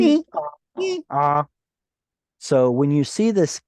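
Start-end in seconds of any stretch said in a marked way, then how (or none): 0.0-1.5 mock donkey hee-haw, high on the ee; high squeal dropping to an ah; donkey bray. Shown as a demonstration. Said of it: "nifal sounds like a donkey".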